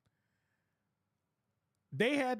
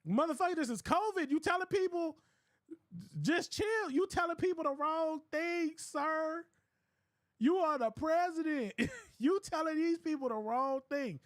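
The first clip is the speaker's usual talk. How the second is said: raised, higher voice in disbelief; mock exasperated yell; 7 semitones higher than usual. Retelling it to friends, a mock exasperated yell at the president. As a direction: shout back at the boss in mock outrage.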